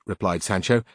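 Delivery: ordinary talk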